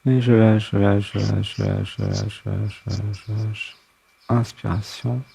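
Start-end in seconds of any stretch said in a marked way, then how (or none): 0.1-3.8 repeated mantra fading to near silence. Acted. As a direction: let each repeat fade softer and softer. Breathing release mantra.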